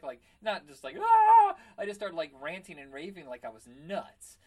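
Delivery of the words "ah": loud, high-pitched mad scream; lunatic scream. Retold or imitated. Victim screaming to scare off his mugger, crazed scream. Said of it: "screaming like a lunatic".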